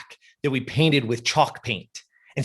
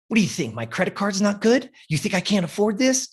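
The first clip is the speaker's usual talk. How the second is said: mock indignation, pitched higher; retold pissed off; 7 semitones higher than usual. Client challenging the trainer over affording it, mildly pissed off. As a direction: imitate an offended customer, rising challenge. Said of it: "She's getting pissed off".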